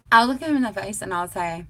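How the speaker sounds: ordinary talk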